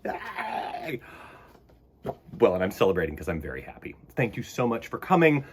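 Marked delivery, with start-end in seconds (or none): 0.1-1.0 soft breathy cheer; whispered yay. Real, not acted. Host whispering a second tiny cheer. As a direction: whisper the celebration. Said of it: "That's me celebrating".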